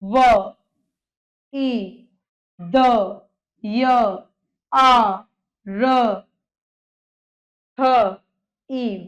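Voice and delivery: each sound drawn out and separated by pauses; teacher's slow phonetic spelling-out; sounding out slowly. Shown as a demonstration. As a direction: break the word into slow, isolated sounds. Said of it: "बोल के देखिए".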